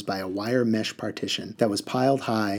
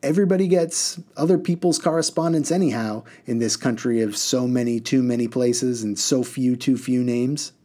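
a show-off bus driver holding forth; cheerful reckless driver. Acted. Driver continues.

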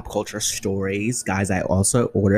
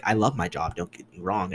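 normal talking voice

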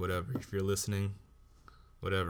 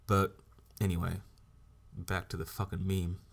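ordinary talk, nothing marked

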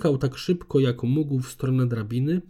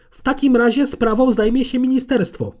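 much higher, louder furious threat; 12 semitones higher, 7 dB louder than usual. A narrator's reading. Conservator threatens Gabriel with the ministry, high and furious. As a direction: spit the threat at him. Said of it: "syknęła".

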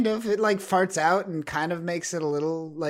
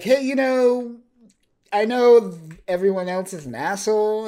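loud, raised-pitch appeal; Havoc; 7 semitones higher, 7 dB louder than usual. Retold or imitated. Havoc arguing to Quanon in the cockpit, acted loud appeal. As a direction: push the point louder and higher, acted.

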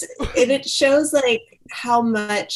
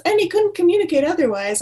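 cavalier; 8 semitones higher, faster than usual. Retold or imitated. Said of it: "very cavalierly says".

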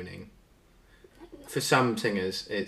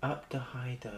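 gently softer, higher-pitched repeat; softer retake; 5 semitones higher, 7 dB quieter than usual. Pretend. Contestant retrying after apologising to headphone users, softer. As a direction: say it softly after the big blast.

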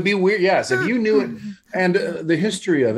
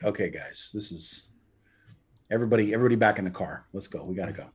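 low, soft retold order; quietly herd the group away; understated, hushed round-up; subdued urging; 6 semitones lower, 6 dB quieter, faster than usual. Retold herding of gawking sailors.

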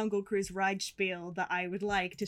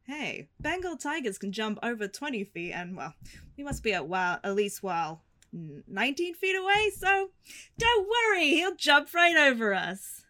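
rising, louder tour guide joke; theme park ride spiel; 4 semitones higher, 4 dB louder than usual. Acted. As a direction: deliver it like a cheesy boat skipper. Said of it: "imagine we're passing ... and I go".

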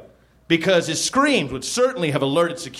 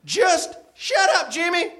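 acted snapping back. A narrator's reading. Narrator plays someone silencing Jimmy, high exasperated yell. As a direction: cut him off in a high, exasperated cry. Another character cuts off Jim.

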